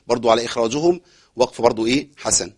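ordinary delivery

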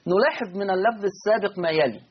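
ordinary delivery

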